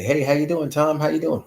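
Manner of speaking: cheery greeting. Retold or imitated. Acting friends' cheerful greeting.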